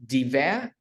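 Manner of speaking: "deve-" with the weight on the second syllable, ve; stress on VE. Shown as a demonstration. Teacher shows learner which sound to stress, stress on VE. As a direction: lean on VE in "deve-". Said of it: "Nếu mình nhấn âm vé thì mình sẽ đọc là".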